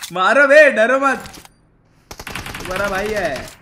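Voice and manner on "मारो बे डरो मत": loud, high battle order; shouted command. Real, not acted. Gamer pushing teammates to attack, shouted command. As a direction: shout the squad on.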